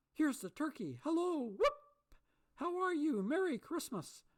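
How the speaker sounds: high, loud whooping; joyful greeting. A narrator's reading. Scrooge greeting the turkey man, joyful whooping shouts. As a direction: hail them with joy.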